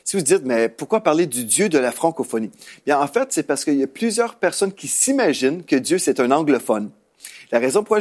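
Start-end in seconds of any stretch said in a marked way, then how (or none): none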